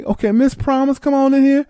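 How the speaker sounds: high, sing-song welcome; mock welcoming hostess. Hostess welcoming Miss Promise in, high and sing-song. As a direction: usher her in sweetly. Hosts greet newcomer.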